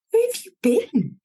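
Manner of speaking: a boss quizzing her missing worker, voiced high; startled boss.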